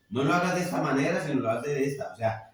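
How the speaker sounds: loud, impassioned pulpit delivery, building in force; fervent preaching. Acted. Pastor warns his congregation about prayer, loud fervent preaching. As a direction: drive the warning home to the congregation.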